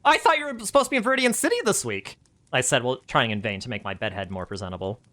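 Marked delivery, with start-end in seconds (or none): 0.0-2.2 flustered surprise. Acted. Flustered narrator voicing herself.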